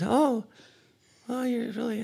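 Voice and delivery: soft, high mock-feminine voice; high woman's voice. Sketch partner pretending to be a woman for a blind man, high soft voice. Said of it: "he's doing this really high voice".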